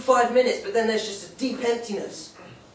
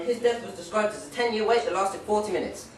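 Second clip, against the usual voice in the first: ordinary talk, nothing marked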